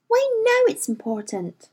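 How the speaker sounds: put-on indignant girl insisting; said hotly. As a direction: push back hotly, not yet shouting. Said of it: "Lyra said hotly".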